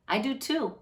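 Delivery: ordinary delivery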